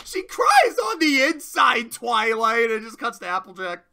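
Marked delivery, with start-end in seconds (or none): 0.1-2.7 much higher and much louder, slow and overwrought; mock dramatic wail. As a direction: declare it like a melodramatic sob. Pinkie's dramatic reply.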